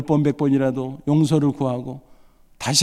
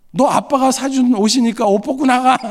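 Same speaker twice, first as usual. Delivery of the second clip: higher, louder, petty fatherly taunt; mock taunting dad; 9 semitones higher, 6 dB louder than usual. Dad taunting his son as he leaves, mock loud. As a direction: play the spiteful dad scoring a point.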